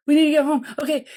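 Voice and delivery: mock panicked mom; frazzled mom. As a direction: hurry them home, frantic. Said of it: "the mom that's like".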